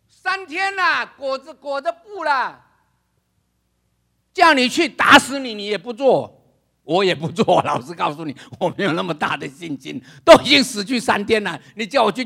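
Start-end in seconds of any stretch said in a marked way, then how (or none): none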